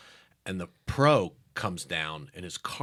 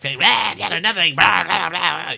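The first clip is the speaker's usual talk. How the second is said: mock ramble; 8 semitones higher, 8 dB louder than usual. Mocking own rambling.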